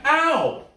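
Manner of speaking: sharp yelp of pain; just got slapped. Real, not acted. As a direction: cry out at a sudden slap. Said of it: "He slapped me".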